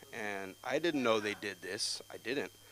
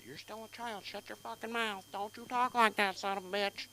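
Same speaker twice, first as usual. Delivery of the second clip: high-pitched put-on telling-off; mock scolding in a raised voice; scolding elder; 10 semitones higher than usual. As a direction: reprimand a mouthy child.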